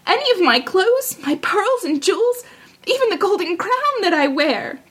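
high, pleading princess voice; high-voiced princess bargaining; eager princess. A narrator's reading. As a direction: offer it all eagerly, pitched high.

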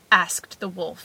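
ordinary delivery

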